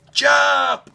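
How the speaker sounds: high, loud order; commanding shout. A narrator's reading. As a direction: yell it as you leap. Librarian orders jump.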